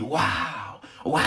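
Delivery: imitating dazzled admirers; exaggerated, admiring gasp of wonder; mock starry-eyed awe. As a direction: draw out the wow as if star-struck. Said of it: "avec les yeux qui brillent".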